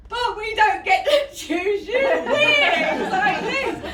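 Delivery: high, slow, loud mock whine through laughter; son's hammed-up disappointed wail, laughing. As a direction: whine like a let-down little boy. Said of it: "And he said".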